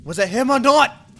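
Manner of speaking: loud, slow exasperated groan rising high; exasperated confusion. Acted. Detective torn over the killer's identity, exasperated yelling. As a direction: shout the frustration.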